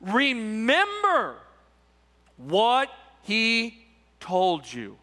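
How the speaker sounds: Remember drawn out very slowly and weighted; slow, underlined Remember; stress on remember. Shown as a demonstration. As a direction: lean hard on Remember, then let what he told you fall away. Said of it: "I want you to underline that word".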